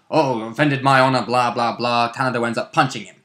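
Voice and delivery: ordinary delivery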